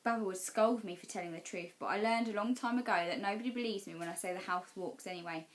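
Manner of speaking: plain reading voice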